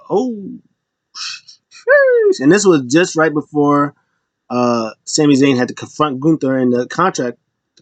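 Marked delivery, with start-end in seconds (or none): 0.1-2.4 very high squeaked sheesh; mock sheesh. Crowd reacting to the jab, high squeak. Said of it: "everybody was like".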